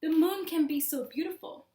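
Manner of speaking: ordinary delivery, nothing marked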